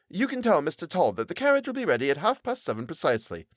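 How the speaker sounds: mild irritated message; curt, a touch annoyed. Acted. Messenger boy telling Tobias, mildly irritated. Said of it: "in a tone of irritation".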